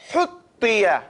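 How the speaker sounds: "Huttiya" with a forceful breathy h at the start; hard ha. Shown as a demonstration. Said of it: "ha has to come hard".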